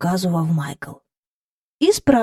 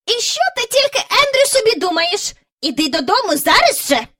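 insisting; 13 semitones higher than usual. A narrator's reading. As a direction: insist he come home now. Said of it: "наполягала міс Жайворон".

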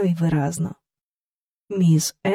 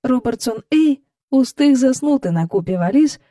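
ordinary delivery

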